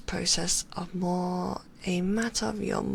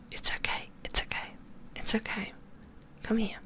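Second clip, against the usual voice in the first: comforting reassurance; 2 semitones higher, 7 dB quieter, faster than usual. Acted. Comforting the frightened familiar.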